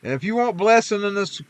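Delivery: ordinary talk, nothing marked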